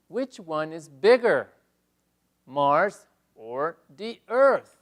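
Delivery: teacher reading the slide question slowly; slow model reading. Shown as a demonstration. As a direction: spell it out slowly for the class. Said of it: "reading slide question to class".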